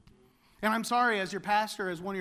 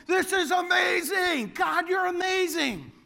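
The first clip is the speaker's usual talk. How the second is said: ecstatic praise; 8 semitones higher, 4 dB louder than usual. Retold or imitated. Shepherds praising God, shouted.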